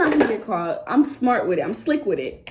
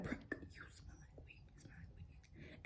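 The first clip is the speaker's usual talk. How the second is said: whisper; 31 dB quieter than usual. Retold or imitated. Classmates whispering in music class.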